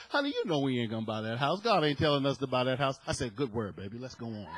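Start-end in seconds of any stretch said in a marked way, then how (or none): 0.1-3.0 low, quick, softer mock-firm voice; imitated wife's refusal. Wife setting her husband straight about the house, low and quick. 3.3-4.5 low, soft, quick surrender; mock-meek agreement. Husband yielding to his wife's word, low and meek. Husband meekly gives in to wife.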